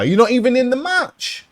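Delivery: high-pitched, indignant objection; exasperated complaint. Real, not acted. Player to Undertaker interfering in the match, exasperated.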